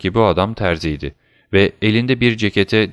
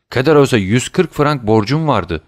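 higher, agitated exclamation; crying out; 6 semitones higher, 4 dB louder than usual. A narrator's reading. Dantes to his father about the neighbour's debt, crying out. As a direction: protest in a raised voice. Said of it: "diye haykırdı Dantes".

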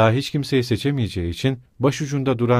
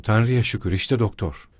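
usual speaking voice

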